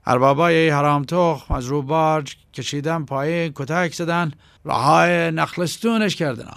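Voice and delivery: a rough southern henchman trying to explain himself; lightly voiced pleading tough guy. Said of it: "عقرب دوباره تلاش کرد توضیحی بدهد".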